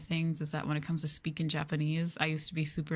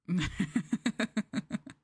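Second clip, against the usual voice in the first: laugh; 3 semitones higher than usual. Real, not acted. Speaker remembers a silly iCarly quote, amused laugh. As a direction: run of quick giggles.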